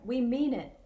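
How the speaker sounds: normal talking voice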